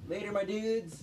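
high excited goodbye. Real, not acted. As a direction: sing out the goodbye. Signing off the show.

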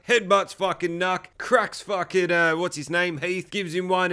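usual speaking voice

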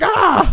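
high, loud "Ah!" of pain; pained yelp. Retold or imitated. Grandson struck by grandma's cane, loud high yelp. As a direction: yelp like a cane just hit you.

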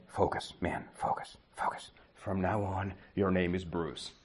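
whispered self pep talk; whisper to himself. Retold or imitated. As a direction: hush it under your breath, psyching yourself up. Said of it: "to myself".

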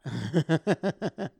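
short loud burst of laughter; amused cackle. Real, not acted. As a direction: laugh off the request. Laughs at booker requests.

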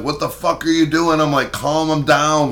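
high, sharp telling-off; bitching at the tow guy; angry snap. Retold or imitated. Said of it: "like that".

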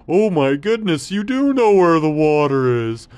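high, loud delighted camel; loud, joyful camel seeing water; excited camel. Acted. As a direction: gasp at the sight with joy. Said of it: "the camel was so happy".

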